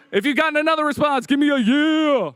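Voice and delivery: rally the room for a yeah; hyped crowd call. Shown as a demonstration. Presenter hyping the hackathon crowd to shout back, excited.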